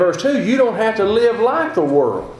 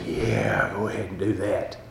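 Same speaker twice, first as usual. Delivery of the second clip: low, hushed devil on the shoulder, much softer; sly devil; 12 semitones lower, 10 dB quieter than usual. Retold or imitated. Preacher voicing the cartoon devil urging sin. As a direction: coax like a tempting little cartoon devil. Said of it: "the devil with his little pitchfork would say".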